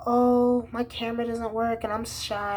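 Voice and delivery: high, coy put-on girl voice; mock girlfriend. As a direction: play her as sweet and innocent to mock her. Imitating girlfriend's excuse.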